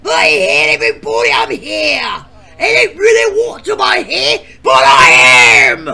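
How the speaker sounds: loud, angry yelling voice; cranky character shout. Acted. New bachelorette greeting everyone with a loud angry shout. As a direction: bark it at the crowd.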